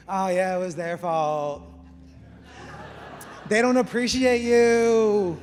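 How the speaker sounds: put-on valley girl. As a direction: play the agreeable roommate, a bit ditzy, stretched out. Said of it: "I don't know why I sound like a valley girl".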